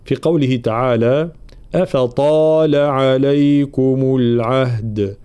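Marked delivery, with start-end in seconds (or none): 1.8-5.2 slow Quran recitation with held vowels; ترتيل قرآني. Shown as a demonstration. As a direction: chant the verse slowly, drawing out the madd. (تلاوة مرتلة متأنية)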